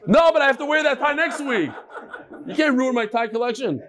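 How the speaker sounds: can't hold back a laugh while protesting. Real, not acted. Teacher joking about his tie rotation, laughing.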